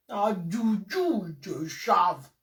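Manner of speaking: very slow sarcastic echo; sarcastic drawl. Real, not acted. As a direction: stretch her slogan out to mock it. Sarcastic slow mock of slogan.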